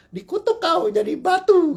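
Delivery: theatrical curse of a wronged parent in a folk tale; mock curse. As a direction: cast it like a storybook mother cursing her son. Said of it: "Kalau dulu langsung".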